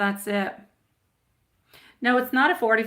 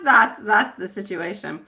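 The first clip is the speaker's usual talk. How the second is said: ordinary talk, nothing marked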